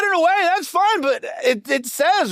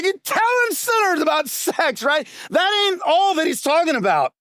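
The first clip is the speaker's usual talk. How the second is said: mock defensive churchgoer; 10 semitones higher than usual. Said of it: "you're like".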